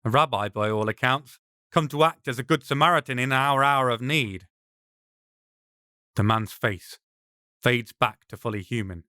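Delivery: ordinary delivery, nothing marked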